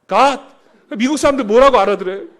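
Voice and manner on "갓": a loud, clipped 갓!; the official answers the Americans with a loud 갓!; short bark. Retold or imitated. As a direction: snap out 갓! as a one-word answer.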